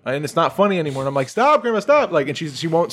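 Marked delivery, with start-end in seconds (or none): 1.3-2.1 high, loud frightened plea; scared pleading. Retold or imitated. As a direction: beg her to stop, really scared. Kid begging grandma during chase.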